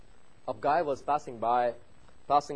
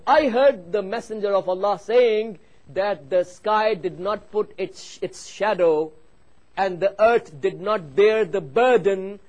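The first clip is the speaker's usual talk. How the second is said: loud, high declamation, stretching the key words; forceful preaching; 6 semitones higher, 9 dB louder than usual. Real, not acted. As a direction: proclaim the hadith to the congregation. Preaches translated hadith at force.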